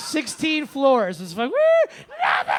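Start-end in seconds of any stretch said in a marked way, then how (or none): 1.5-2.5 hammed-up defiant bellow; triumphant drunken yell. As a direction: holler it while hurling something out the window. Repeats yell as box is thrown.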